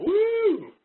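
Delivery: high, loud cheer; excited whoop. Real, not acted. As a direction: whoop for the home team. Cheers for Baylor.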